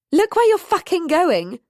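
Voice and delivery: high, loud, aggrieved upper-class rebuke; haughty woman, bumped in the dark, sharp and loud; aggrieved aristocratic snap. A narrator's reading. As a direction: bark it out, offended and posh. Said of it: "said an aggrieved, aristocratic voice".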